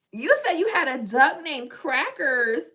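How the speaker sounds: loud, high disbelief ending in a gasp; high, loud astonished outburst; shocked realisation. Real, not acted. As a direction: blurt it out aghast, then gasp. Shocked at viewer's duck named Crackers.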